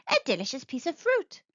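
lightly voiced sneaky fiend. Fiend voice in story.

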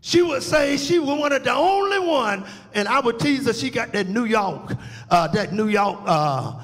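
ordinary delivery

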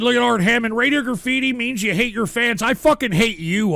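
usual speaking voice

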